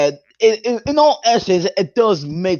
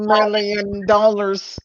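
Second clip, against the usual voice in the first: evil scientist voice; 3 semitones higher, slower than usual. Pretend. Joins the evil scientist bit.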